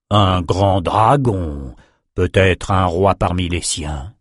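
very slow, drawn-out awe; lyrical sorcerer. A narrator's reading. Sorcerer marvelling at a great dragon, slow and lyrical. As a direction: savor each word like a poet. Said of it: "le lyrisme du sorcier".

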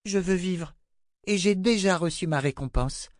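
put-on a child saying sorry to the queen; young girl Rachel. A narrator's reading. Girl apologizing to the queen, meek.

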